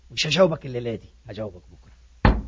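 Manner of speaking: very low, soft putting-off; subdued acted reply. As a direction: act out a hushed, low-pitched deferral.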